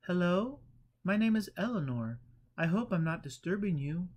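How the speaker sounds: Eleanor. A narrator's reading. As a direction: apologise for intruding.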